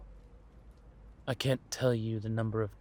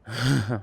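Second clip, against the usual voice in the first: brief, breath-led laugh; a quick chuckle before replying; short chuckle; 7 dB louder than usual. Acted. Speaker to listener new to this sort of thing, short chuckle.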